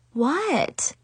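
falling, dismissive question; shrugging what. A narrator's reading. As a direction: play innocent with a verbal shrug. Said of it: "I shrugged at her".

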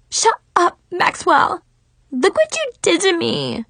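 high furious screaming; Mackenzie. A narrator's reading. Rival screaming at Maxwell over her ruined look, furious. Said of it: "she screamed".

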